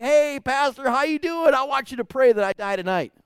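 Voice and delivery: put-on high, loud cheery old patient; a dying man greeting his pastor, cheerful and loud. Dying man to his pastor, loud and cheerful. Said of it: "and he's like".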